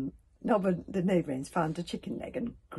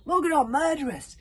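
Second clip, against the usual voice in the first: exclamation; 9 semitones higher, 8 dB louder than usual. Acted. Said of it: "said Stoic".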